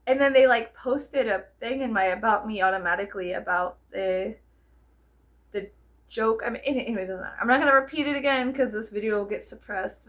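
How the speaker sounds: normal talking voice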